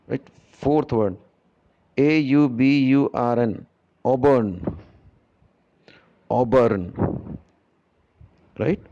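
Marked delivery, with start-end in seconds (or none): 4.0-7.4 'auburn' said clearly, twice; model pronunciation. Shown as a demonstration. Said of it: "I will be pronouncing each and every word".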